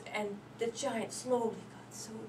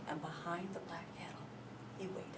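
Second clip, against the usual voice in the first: whispered, much softer; hushed whisper; 5 semitones lower, 13 dB quieter than usual. A narrator's reading. Narrator telling Jack hiding, whispered suspense.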